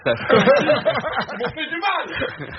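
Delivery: laughing through a mock complaint; high laughing mock hurt. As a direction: giggle it out, pretending to be wounded. Mock-hurt aside about ex-colleagues.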